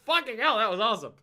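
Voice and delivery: loud, high thrilled exclamation; excited outburst. Retold or imitated. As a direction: blurt it out, thrilled.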